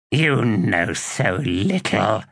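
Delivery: put-on slow, scornful old witch; contemptuous. A narrator's reading. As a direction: let contempt drip from each word. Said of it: "her tone filled with contempt".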